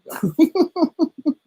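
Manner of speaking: real high-pitched giggling laugh; squeaky laughter trailing away. Real, not acted. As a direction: crack up in a long, high run of giggles. Laughing at own joke.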